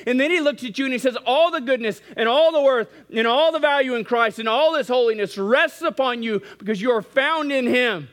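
high, loud, ringing sermon climax; full-force preaching. Acted. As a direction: preach it out, building to the peak.

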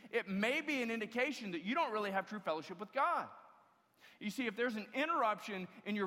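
loud, lifted preaching with punched words; full-force preaching. Acted. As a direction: drive the point home to the congregation.

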